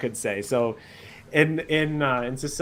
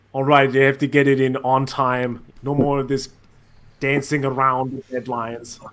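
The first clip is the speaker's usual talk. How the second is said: motivational speaker; 6 dB louder than usual. Pretend. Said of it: "like motivational speaking".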